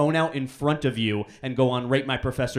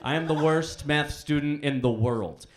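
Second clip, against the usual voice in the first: ordinary delivery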